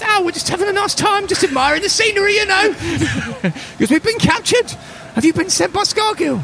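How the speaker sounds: very high chirpy goblin voice; small goblin voice. Acted. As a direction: chatter high like a nervous little goblin. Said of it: "And the little one goes".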